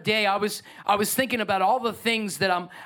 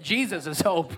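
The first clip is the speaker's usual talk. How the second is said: mean every word of "Jesus is hope"; like you mean it; 2 semitones lower than usual. Shown as a demonstration. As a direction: say it with real conviction. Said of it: "say it like you mean it".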